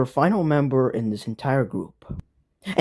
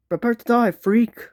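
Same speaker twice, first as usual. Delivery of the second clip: villain threat; 8 semitones higher than usual. Acted.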